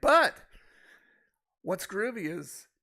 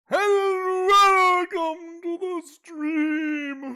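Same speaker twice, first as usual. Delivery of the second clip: slow, drawn-out announcer welcome; old radio show; 9 semitones higher, 9 dB louder, much slower than usual. Pretend. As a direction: stretch it like a vintage broadcaster. Stream opener after TV clip.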